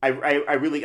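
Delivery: usual speaking voice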